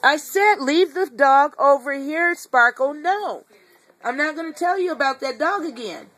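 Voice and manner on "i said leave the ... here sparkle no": slow, louder, firm command; stern command. Real, not acted. Owner ordering Sparkle to leave the dog toy, slow and louder. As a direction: lay down the law slowly.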